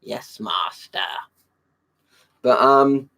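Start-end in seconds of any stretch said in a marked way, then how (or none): 0.0-1.0 whispered hunchback servant voice; hunchback assistant. Pretend. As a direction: hiss it like a cringing hunchback. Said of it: "um, hunchback impression".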